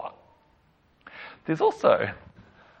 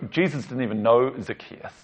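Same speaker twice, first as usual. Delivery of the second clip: normal talking voice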